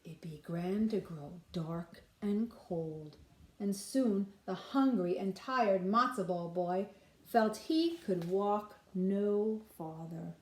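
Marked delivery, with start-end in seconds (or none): none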